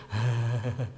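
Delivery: breathy "hhh" like someone shivering; imitated shiver breath; shivering exhale. Shown as a demonstration. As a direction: shudder out a long airy h. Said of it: "itu huruf H".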